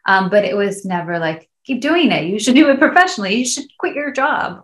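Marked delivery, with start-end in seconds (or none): 1.6-4.6 higher, louder pretend cheering-on; voicing keen encouragement in a higher register; imagined eager encouragement. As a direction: mimic parents urging you on, pitched up.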